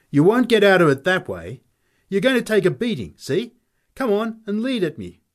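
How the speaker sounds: high-pitched, goading challenge; menacing taunt. Acted. Fighter taunts Nick into a fight, high and menacing. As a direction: taunt him, keeping the pitch way up.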